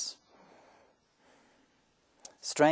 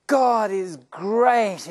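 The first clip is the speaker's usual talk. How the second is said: high, loud, drawn-out exclamation; rapturous praise; 8 semitones higher, 8 dB louder, much slower than usual. Acted. Crash survivor to his brother, rapturous loud praise. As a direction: proclaim it slowly in overflowing relief.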